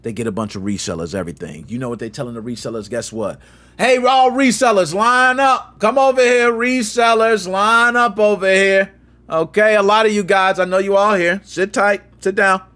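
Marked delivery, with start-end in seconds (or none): 3.8-8.9 calling out. Retold or imitated. Company calling resellers to line up.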